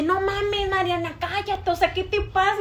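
high-pitched mock scolding; scolding dad. Dad shushing his daughter over a nickname, mock-scandalised. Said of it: "Y su papá así de".

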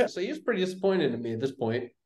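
ordinary delivery

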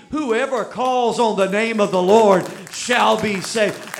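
loud, slow, preaching; full-force preaching. Acted. Preacher proclaiming the verse loudly to the congregation. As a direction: proclaim it to the back row.

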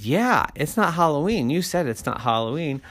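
put-on insistent little-kid comeback; arguing kid. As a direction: argue back at dad like a kid. Jimmy argues back at Daddy.

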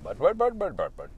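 exaggerated Indian accent mimicking chatter; caricatured Indian accent, playful; cliché Indian accent. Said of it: "cliche Indian accent".

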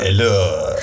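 put-on low French-accented greeting. Co-host joins the French accent forfeit, French accent. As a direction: echo it in a mock French accent. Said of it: "you will do French".

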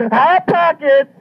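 high, slow squealed punchline; mock shout. Mama yelling the punchline at her guest, high mock shout.

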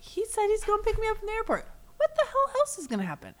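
very high, giddy imitation of triumph; mock celebrating. Host mimicking Yolanda's airport win, high-pitched mock celebrating. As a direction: gush as if it's a huge win. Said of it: "celebrating this as a win".

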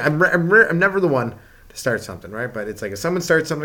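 ordinary talk

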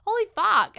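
high, loud reenacted shock; shocked outburst. After being pecked by a date, shocked exclamation. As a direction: burst out in stunned disbelief. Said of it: "I was just so shocked".

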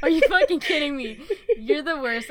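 loud laughing mock scold. Real, not acted. Host laughing at cohost, loud mock scold.

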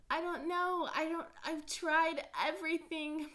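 loud and in your face; obnoxiously loud. Real, not acted. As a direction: crank it up on purpose. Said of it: "if I talk obnoxiously loud".

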